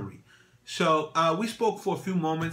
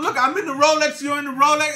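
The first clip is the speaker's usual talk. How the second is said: higher, louder, eager to show off; excited; 12 semitones higher, 10 dB louder than usual. Retold or imitated. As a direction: proudly shout to the owner. Bonding over Rolex, excited.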